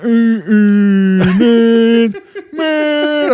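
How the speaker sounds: high, drawn-out mock moan; mock a friend with long high whines; imitating cohost's moaning. Comic mocking his cohost's life, whiny imitation. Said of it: "and then you".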